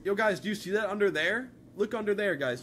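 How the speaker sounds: loud, eager calling out to chat; excited urging. Real, not acted. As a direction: get them to look, now.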